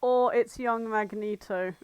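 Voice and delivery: ordinary talk, nothing marked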